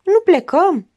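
high, spoiled schoolgirl whine; whiny. A narrator's reading. Architect asking whether they are leaving, whiny. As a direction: plead like a pampered child. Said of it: "întrebă pe un ton plângăreț".

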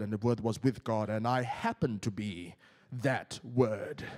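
hammed-up solemn holy voice, deep and deliberate; putting up a front. Preacher mocking a prayer Jesus never put on, pompously composed. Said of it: "was he putting up a front".

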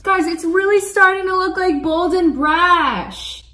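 high, loud excited announcement; thrilled. Real, not acted. Thrilled at her painting.

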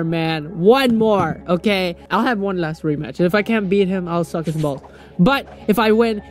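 ordinary talk

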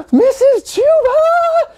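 piercing, drawn-out scream; loud high-pitched child screech. A narrator's reading. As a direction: scream the teacher's name, slow and very high. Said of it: "she screamed".

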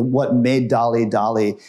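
usual speaking voice

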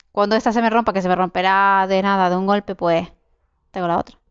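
ordinary delivery

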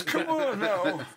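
put-on slow, high creaky old man. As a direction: drag it out like an old-timer. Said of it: "doing the old man voice".

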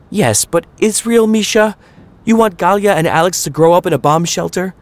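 high, pleading objection; anxious mother arguing. A narrator's reading. Mama objecting to Papa about Israel, high anxious plea. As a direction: push back on him, worried for the kids.

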